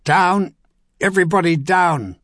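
loud, high warning shout. A narrator's reading. Philip warning everyone of the volley, loud high shout. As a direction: yell at everyone to hit the ground. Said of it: "Philip Osgood shouted".

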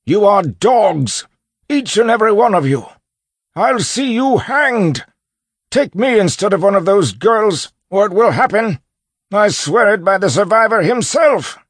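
high, full-force defiant tirade; furious yell. A narrator's reading. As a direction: hurl threats at the whole gang. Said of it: "Peterus yelled".

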